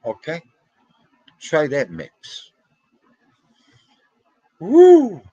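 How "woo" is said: loud high whoop; excited whoop. Real, not acted. Streamer cheers a half-Irish half-Sicilian viewer with a whoop. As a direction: let out a big cheer.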